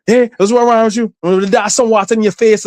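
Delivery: mock the mother's scolding question. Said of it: "she's like".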